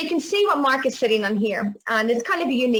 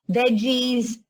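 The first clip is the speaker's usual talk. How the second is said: ordinary talk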